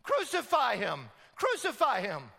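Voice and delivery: loud yelling crowd chant. Retold or imitated. Crowd demanding crucifixion from Pilate, loud yell. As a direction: yell it like an angry mob. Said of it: "They yell".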